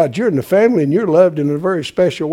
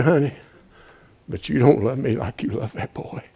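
ordinary delivery

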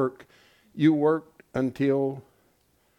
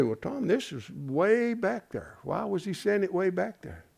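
imitating a doubtful listener; 4 semitones higher, 6 dB quieter than usual. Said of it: "You say".

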